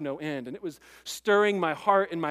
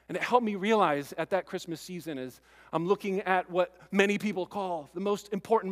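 usual speaking voice

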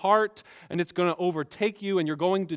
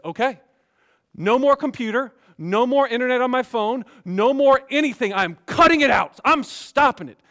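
eager resolve; 6 semitones higher, 7 dB louder than usual. Retold or imitated. Imagined man resolving loudly.

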